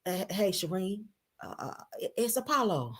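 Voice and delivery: hesitant, stammering voicemail; Apollo. Acted. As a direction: trail off softly like a nervous begging ex. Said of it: "on the phone like this".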